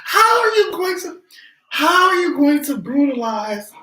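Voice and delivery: high, cracking with laughter; can barely get it out for laughing; crying with laughter. Real, not acted. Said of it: "I am really crying".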